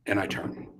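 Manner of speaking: ordinary talk, nothing marked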